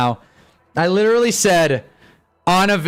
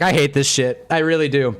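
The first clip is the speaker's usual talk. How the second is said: ordinary talk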